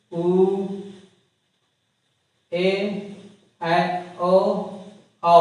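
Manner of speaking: each vowel spoken separately and held, with pauses between; reciting Hindi vowels. Shown as a demonstration. Teacher reciting the vowel sounds slowly to students. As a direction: count off the vowels one by one for the class. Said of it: "इनका sound आएगा".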